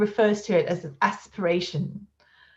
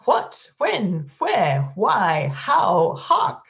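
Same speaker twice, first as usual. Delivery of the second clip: rising, louder exclamations, each breath pushed out; hammed-up exclamations, climbing higher; 5 semitones higher, 4 dB louder, much slower than usual. Shown as a demonstration. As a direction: shoot out each word on full breath. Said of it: "as in the exclamations".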